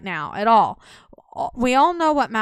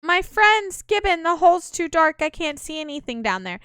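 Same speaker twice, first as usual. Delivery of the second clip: high raised voice of alarm; worried character call; 6 semitones higher than usual. Acted. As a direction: call out anxiously to the group.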